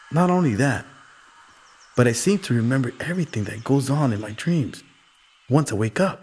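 put-on young boy voice. Kino recounts his dream.

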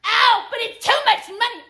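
very high whiny mimicry; exaggerated whining imitation; mocked complainers. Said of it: "People are like".

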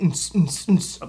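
mouthed techno pulse, "ns, ns, ns"; techno beat imitation. Recalling techno music on the street, techno beat imitation. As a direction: imitate a techno track with short hissing beats. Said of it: "I hear techno music".

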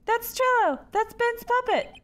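high eager recognition; excited. Real, not acted. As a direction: point it out eagerly.

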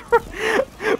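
laughing high at own voice crack. Real, not acted. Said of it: "Oh, I just had a voice crack".

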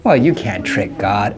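usual speaking voice

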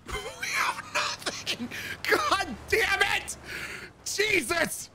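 high-pitched exasperated outburst; frantic frustration. Real, not acted. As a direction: wail in disbelief at having nothing. Finds no keys, lockpick, nothing.

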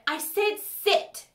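high, slow, sharp order; imperious, high-pitched rebuke; snapped command. A narrator's reading. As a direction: snap an order, each word drawn out. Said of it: "Vasilisa snapped".

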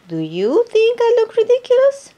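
high, hopeful hippo voice. Acted. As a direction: ask it as the anxious hippo. Hippo asks each animal.

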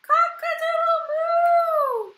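high, loud, drawn-out moo-crow; crowing cow. A narrator's reading. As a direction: crow slowly, letting the moo ring out. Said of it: "cow went".

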